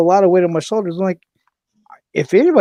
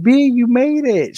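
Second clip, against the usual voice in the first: excited greeting; 10 semitones higher, 5 dB louder, slower than usual. Retold or imitated. Roxanne greeting, high excited voice.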